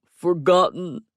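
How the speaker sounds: slow, loud mock-tragic moan; overacted woe. A narrator's reading. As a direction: moan it like a drama king. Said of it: "he moaned".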